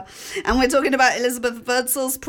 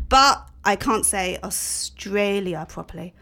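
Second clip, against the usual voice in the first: normal talking voice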